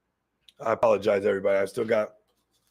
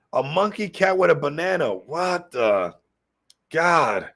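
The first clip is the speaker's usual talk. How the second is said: ordinary talk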